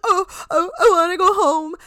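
high-pitched imitation of crying; mock sobbing. Podcaster imitating a homesick contestant, mock sobbing. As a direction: wail it like a retold sob. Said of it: "fucking sobbing".

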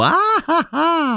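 high-pitched eerie "ah ah ah"; creepy baby vocalizing. Shown as a demonstration. Host mimicking babies' vocalizing, comes out creepy. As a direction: coo like a killer doll. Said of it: "non sembrano delle bambole assassine come ho fatto io".

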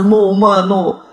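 slow tough-guy drawl; macho dude voice. Retold or imitated. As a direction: imitate a macho bro. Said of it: "que fala assim".

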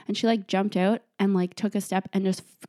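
ordinary talk, nothing marked

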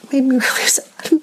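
a teary half-laugh through rising emotion; real tearful laugh, voice rising. Real, not acted. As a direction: laugh through tears as it hits her. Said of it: "I'm getting emotional again".

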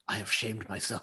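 low, hushed, ashamed cat voice; mock-solemn confession, very soft and low; Puss in Boots. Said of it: "He's like".

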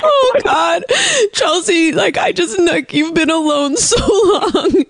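high, tearful imitation of a friend welling up; mock crying. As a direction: play the weepy friend, voice cracking high. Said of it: "And she started crying".

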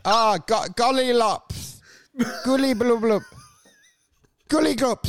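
stumbling on "golly lops", breaking it off and restarting; mangled surnames. Retold or imitated. MC failing at last names on stage, bungling "goolly glup". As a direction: garble "goolly blub blub" into babbled nonsense syllables, haltingly. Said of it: "I'm very bad with last names".

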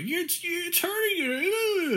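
very high, drawn-out whine; slow mock-distressed squeal; mock Hermione. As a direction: plead in an exaggerated high voice. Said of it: "I don't know what Hermione's getting at".

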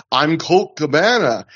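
slow, put-on mock Colt Cabana voice; a deliberately bad impression, drawn out slowly; horrendous Colt impression. Heel Claudio mocking Colt Cabana with a slow bad impression. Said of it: "his hilariously horrendous impression".

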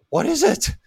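mock high-voiced query; high-pitched questioning. Tenant's startled reply, voiced in story.

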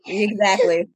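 laughing through the word. Real, not acted. Laughing agreement.

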